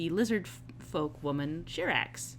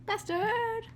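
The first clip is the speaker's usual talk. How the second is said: high, delighted call of the name; excited shout. Acted. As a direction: greet him like an old friend you missed. Excited greeting of returning companion.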